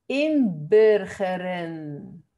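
inburgeren, very slow, syllable by syllable, with a Dutch rounded vowel; model pronunciation. Shown as a demonstration. Teacher modelling "inburgeren" for learners, slow Dutch pronunciation. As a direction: sound out "inburgeren" slowly and carefully in Dutch. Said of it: "saber decir esta palabra bien".

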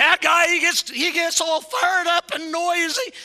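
high, full-volume shout imitating a critic; mock yelling. Congregation complaining about the noisy preacher, parodied in a yell. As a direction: holler it at pulpit volume. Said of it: "'cause I yell".

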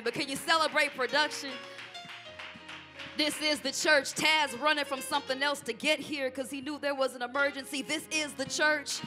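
ordinary talk, nothing marked

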